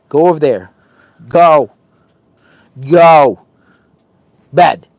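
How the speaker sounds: loud, firm commands to the dog; stern dog command. Real, not acted. Owner sternly sending his escaping dog to bed. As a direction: order the dog back to bed.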